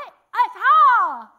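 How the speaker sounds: slow, loud words through a jaw locked open; mouth stuck open. Retold or imitated. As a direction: shout through a mouth that will not close, half laughing. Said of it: "spit was pooling ... couldn't spit".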